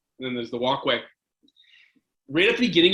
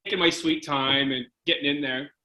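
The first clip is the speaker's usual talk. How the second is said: normal talking voice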